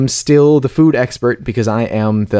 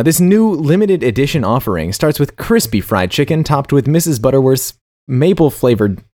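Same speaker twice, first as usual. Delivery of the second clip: usual speaking voice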